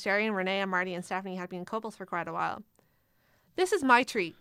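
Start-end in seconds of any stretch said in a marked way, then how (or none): 3.5-4.3 bright, loud announcement; announcing. A narrator's reading. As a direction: announce it to the table. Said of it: "Karen announced".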